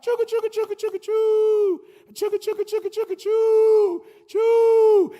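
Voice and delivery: high, hammed-up chugga chugga choo, last choo shouted; train chugging noise. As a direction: make the little train puff up the track. Little train starts moving.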